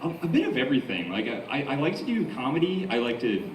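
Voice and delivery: usual speaking voice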